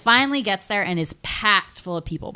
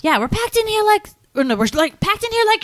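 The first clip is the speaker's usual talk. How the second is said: protesting bystander; 3 dB louder than usual. Retold or imitated. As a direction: complain loudly from inside the crush. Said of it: "And the guy's like".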